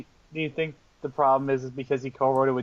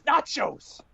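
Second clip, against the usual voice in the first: higher, exclaimed mock movie line; Adam Driver quote; 10 semitones higher than usual. Friend quoting Adam Driver's trailer line, higher exclaim. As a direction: blurt it like the trailer moment. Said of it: "just shows up and says".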